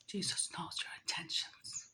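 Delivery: very soft, secretive whisper; barely audible, whispered aside. Real, not acted. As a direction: drop to a hushed whisper for the knowing repeat. Repeats the line in a whisper.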